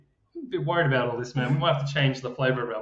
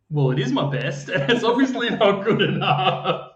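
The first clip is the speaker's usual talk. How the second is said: laughing defeat; 7 semitones higher, 7 dB louder than usual. Retold or imitated. Beaten player answers the taunting boss, laughing. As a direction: admit defeat while laughing.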